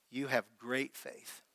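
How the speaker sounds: slow, soft and low; tender. Retold or imitated. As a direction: say it like a gentle blessing. Jesus quoted praising faith.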